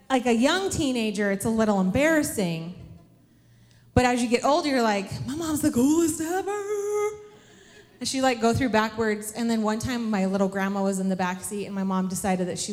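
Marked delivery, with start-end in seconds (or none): 5.3-6.6 high, sing-song mock boast; hammed-up gushing. Grown child boasting about mom, gushing in a high put-on voice. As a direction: gush with swooping, exaggerated pride.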